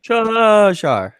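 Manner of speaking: high "Shushar", first vowel held long; "Shushar" stretched long on a high pitch; drawn-out syllable. Shown as a demonstration. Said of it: "You gotta make the user long".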